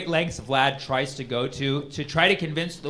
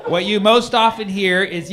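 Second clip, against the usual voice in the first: usual speaking voice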